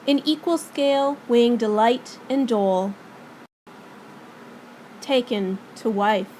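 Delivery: measured, projected Shakespearean reading with long pauses; dramatic monologue recitation. Acted. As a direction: declaim it as a king's formal speech, drawing out phrase endings. Recites Claudius's monologue from Hamlet.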